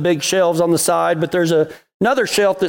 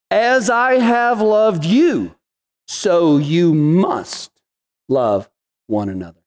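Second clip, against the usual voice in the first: slow scripture reading; 2 semitones lower, slower than usual. Acted. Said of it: "He says".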